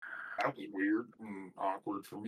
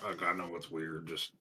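softer, lower confiding aside; whispered aside; 6 semitones lower, 6 dB quieter than usual. Acted. Player confides in the abbot's ear, hushed aside. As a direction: lean in quietly, half whispering. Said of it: "I whisper in his ear".

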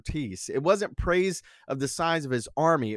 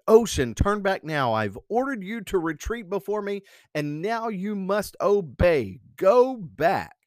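high, hammed-up kingly commands; Canute shouting; 7 semitones higher, 3 dB louder than usual. A narrator's reading. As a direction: order the ocean back, ending loud. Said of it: "Canute shouted".

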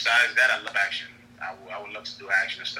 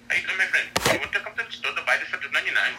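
higher, eager Arabic-accented shopkeeper pitch; 7 semitones higher, 4 dB louder, faster than usual. Shown as a demonstration. Actor demos his Arabic accent as a shopkeeper, higher and brighter. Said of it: "I could just talk, like an Arabic".

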